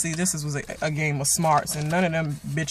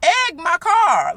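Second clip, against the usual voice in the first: much higher, louder and slow; outraged; 13 semitones higher, 5 dB louder, slower than usual. Real, not acted. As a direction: spit it out in disbelief. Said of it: "I'm mad".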